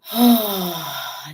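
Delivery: big audible open sigh; loud sigh. Real, not acted. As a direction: let it all out with a long sigh. Release during meditation.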